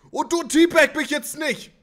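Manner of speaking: high, loud outraged shout; real outraged yelling; indignant yell. Real, not acted. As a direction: yell at the player mocking you. Indignant yell at other player.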